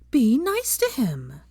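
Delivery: lightly voiced young woman, scolding slowly; Hermione, firm. A narrator's reading. Hermione scolding Pansy for insulting Creature, firm.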